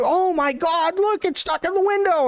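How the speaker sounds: high, hammed-up gasp of wonder; mock astonished onlooker; mock amazement. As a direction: play the gullible fan, wide-eyed. Mocking amazed crowd.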